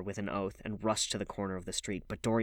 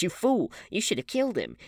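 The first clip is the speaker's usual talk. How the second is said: shrill, much higher and louder scolding; furious outburst; 11 semitones higher, 6 dB louder than usual. A narrator's reading. Woman screaming at James Vane for letting him go, furious. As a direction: lash out at him in fury.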